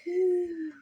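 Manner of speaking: put-on storybook baby ghost cooing; contented baby coo. A narrator's reading. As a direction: coo like a happy infant. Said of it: "said baby ghost contentedly".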